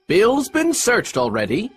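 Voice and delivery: high, slow reporting voice; pirate henchman. A narrator's reading. Fellow at the inn door answers Pew, calling out.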